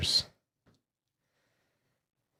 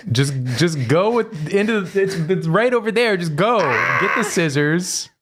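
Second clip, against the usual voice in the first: exasperated urging; 8 semitones higher than usual. Real, not acted. Urging partner to cut bracelet.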